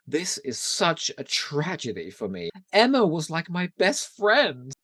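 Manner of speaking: slow, self-pitying whine rising at the end; milk the tragedy, all about herself; Cordelia's whine. Retold or imitated. Co-host retells Cordelia's selfish line, slow and self-pitying. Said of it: "she goes".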